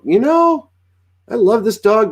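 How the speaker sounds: high-pitched imitation of an apologetic owner; pleading dog owner. Acts owner wanting to rehome dog.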